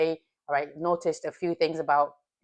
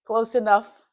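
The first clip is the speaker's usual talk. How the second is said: ordinary delivery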